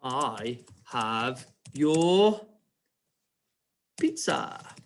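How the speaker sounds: very slow, word-by-word dictation; drawn-out words with long gaps. Shown as a demonstration. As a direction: spell out each word slowly for pupils writing along. Dictating slowly while writing.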